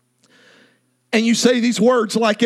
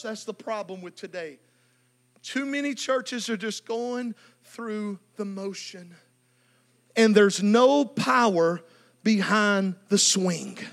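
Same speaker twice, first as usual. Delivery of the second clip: much softer and lower; hushed lament; 6 semitones lower, 7 dB quieter than usual. Real, not acted. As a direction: drop to a heavy hush. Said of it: "sermon drops to low intensity".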